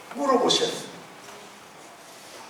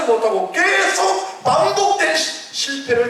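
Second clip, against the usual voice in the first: raised, fervent sermon voice; rising pulpit fervour; preaching at full force; 13 semitones higher, 7 dB louder than usual. Acted. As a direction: preach it up high and strong. Preacher building up.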